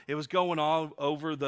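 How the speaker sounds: ordinary talk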